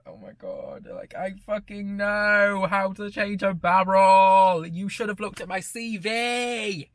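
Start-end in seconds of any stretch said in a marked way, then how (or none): 1.1-6.9 high, slow, drawn-out wail of frustration; exasperated shout. Retold or imitated. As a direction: belt it out, stretching each word in indignation. Acted outburst at the manager.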